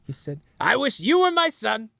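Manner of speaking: high, soft Trump voice telling a tearful tale; Trump impression. Trump speech bit told to his Republican family, high mock voice. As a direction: do Trump retelling a sentimental story. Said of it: "where Trump does a speech".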